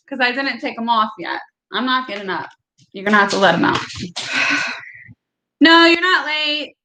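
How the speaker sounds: normal talking voice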